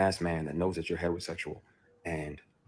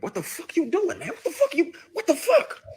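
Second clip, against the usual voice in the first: high-pitched outraged yell; retold freak-out, loud and very high; spazzing out; 7 dB louder than usual. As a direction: yell it in escalating disbelief, voice shooting way up. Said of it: "you spaz even more".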